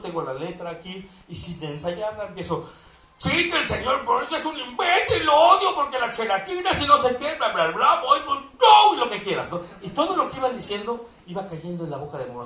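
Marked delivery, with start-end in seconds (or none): 3.2-8.9 high, loud babbling rant; dubbing Homer at full blast with a laugh; Homer-style dub rant. Pretend. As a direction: rattle it off like a cartoon tirade. Performing the Homer dub take.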